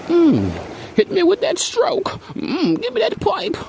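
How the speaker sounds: breathy high falsetto moan; mock moaning woman. Imitating woman in bed.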